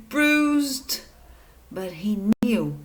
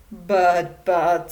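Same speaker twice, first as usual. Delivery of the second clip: spluttering; 4 semitones lower, 4 dB louder than usual. Acted. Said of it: "spluttered Mister Hamstring".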